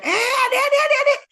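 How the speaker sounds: very high, loud acted shriek; alarmed yell. Father stopping child pouring liquid into motorbike, yelling. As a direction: yelp to stop the kid, hammed-up. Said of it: "baru teriak".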